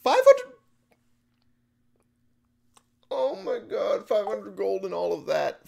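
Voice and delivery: very high, squealed shock; real high-pitched dismay; shrieked disbelief. Real, not acted. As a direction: open on a loud shriek, stay high in disbelief. Stunned at losing five hundred gold.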